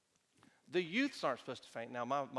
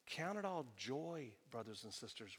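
soft reread; 2 semitones lower, 7 dB quieter than usual. Shown as a demonstration. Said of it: "Listen to what he's saying".

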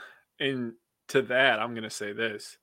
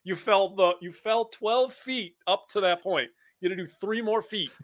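mock exasperation; 7 semitones higher than usual. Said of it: "I was like".

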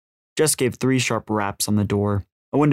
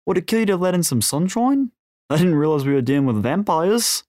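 Australian smuggler James, joking; 8 semitones higher than usual. A narrator's reading. James mocking the older man's dark room, joking Australian. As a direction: crack a joke in broad Australian.

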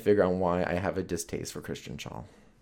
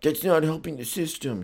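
higher, nagging mock voice; mock critic; 9 semitones higher, 3 dB louder than usual. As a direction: parody a preachy naysayer. Imitating a scolding critic.

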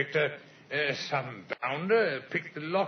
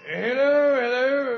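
calling hello into the telephone, higher and louder; loud phone hello; 5 semitones higher, 8 dB louder than usual. Acted. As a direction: raise the voice down a phone line. Answering a ringing telephone.

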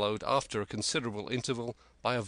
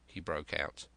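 usual speaking voice

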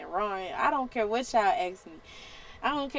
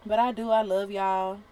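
usual speaking voice

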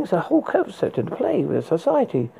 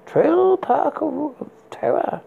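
slow, higher spooky title announcement; horror host; 7 semitones higher, slower than usual. Acted. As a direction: stretch the title out like a creepy host. Announcing next film title.

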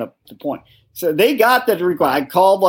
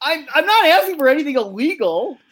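high, slow, cracking what the fuck; incredulous, near laughing; exasperated disbelief; 10 semitones higher than usual. Retold or imitated. Retelling his disbelief at the courthouse clerk, high and incredulous.

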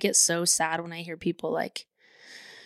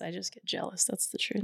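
hushed, half-whispered admission; owning up under the breath; quiet confession; 8 dB quieter than usual. Real, not acted. Confessing jealousy softly.

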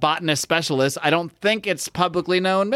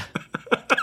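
laugh; 3 dB quieter than usual. Real, not acted. Laughing at own bit.

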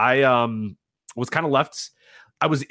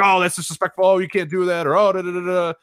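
mocking offended fans; 8 semitones higher, 4 dB louder than usual. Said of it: "everyone's like".

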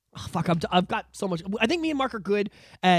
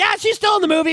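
brushed off, higher and a touch louder; dismissive mock voice; 10 semitones higher, 5 dB louder, faster than usual. To the podcast co-host, mocking Hollywood's shrug-off. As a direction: wave it away like it's nothing. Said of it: "they're just like".

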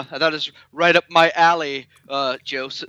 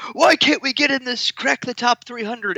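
much higher, whiny complaint; mock whine; 11 semitones higher than usual. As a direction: whine like a frustrated podcaster.

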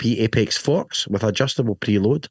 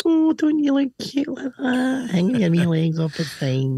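much higher, slow cooing mock wife voice; imitating wife; slower than usual. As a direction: coo it like a teasing wife, pitched way up. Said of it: "As my wife would say, she went".

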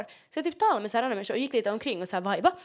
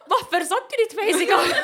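high, louder, laughing question; laughing baffled question; 10 semitones higher, 10 dB louder than usual. Retold or imitated. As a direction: ask it through a laugh, baffled. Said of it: "Jag är så här". (skrattande förvånad fråga, högre och starkare)

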